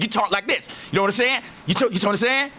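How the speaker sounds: mock fast New York city talk.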